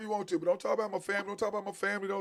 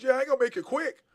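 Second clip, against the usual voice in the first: mock threat; 5 semitones higher, 4 dB louder than usual. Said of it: "He goes".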